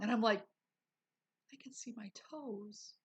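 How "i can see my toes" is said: hushed awe. Retold or imitated. Awed quote to the nurse.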